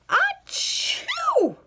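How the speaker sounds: very high loud achoo; sneeze. A narrator's reading. Louise sneezing at ballet, huge high sneeze. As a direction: explode into a sneeze.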